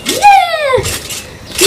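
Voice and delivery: high drawn-out cheer; triumphant yeah. Real, not acted. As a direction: cheer the win. Landing the front flip.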